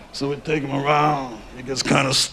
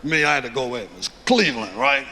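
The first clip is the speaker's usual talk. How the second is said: lower, murmured mobster storytelling; old gangster reminiscing about a hit; Mafia accent; 4 semitones lower than usual. Retold or imitated. Mobsters swap murder stories, low Mafia accent. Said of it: "his accent is killing me".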